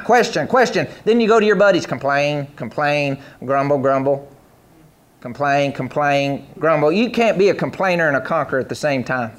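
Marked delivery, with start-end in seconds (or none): 1.9-6.9 low, muttered mock grumbling. Preacher mocking people who complain to buddies, low mock grumbling.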